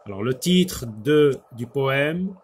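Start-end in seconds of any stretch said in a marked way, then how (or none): none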